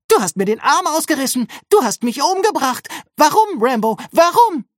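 high, loud hammed-up anguish; screaming accusation. A narrator's reading. Nurse pranks Rambo about her arm, screamed accusation. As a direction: wail it, building to the last cry. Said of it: "schrie sie".

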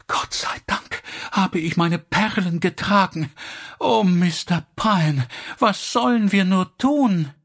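high, helpless plea; distraught appeal. A narrator's reading. Elsie turns to Mister Pyne after the theft, distraught. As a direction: plead for help in a high, upset voice.

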